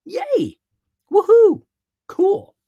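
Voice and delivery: high, loud little cheers; mock cheering. Coach to audience, cheering a small win excitedly. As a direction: celebrate a tiny win with glee.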